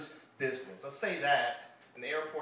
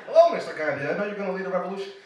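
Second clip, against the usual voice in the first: loud, grand mock-respectful hello; mock formal greeting; 4 semitones higher, 11 dB louder, much faster than usual. Comic mocking a teacher greeting young Gandhi, hammed-up. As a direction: greet him like a dignitary, hammed up. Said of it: "It wasn't just like".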